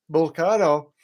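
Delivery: Volcano with a broad "ah", vol-KAH-no; intended pronunciation. Shown as a demonstration. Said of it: "I went to say Volcano".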